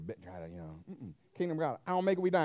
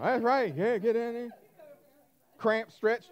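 usual speaking voice

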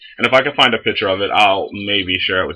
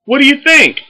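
mock baker; 7 dB louder than usual. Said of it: "And I'm like".

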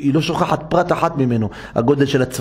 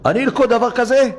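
much higher, incredulous cry; aghast disbelief; 9 semitones higher than usual. Retold or imitated. Wife told to catch the scorpion, incredulous. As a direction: balk at the idea in shock.

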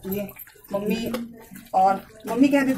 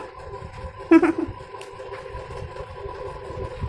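breathy laugh; 7 semitones higher, 3 dB quieter than usual. Real, not acted. Laughs after calling son.

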